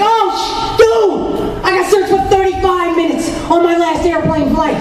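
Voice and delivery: second friend one-upping, excited shout. Retold or imitated. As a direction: top his story, all worked up. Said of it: "the second dude will be like".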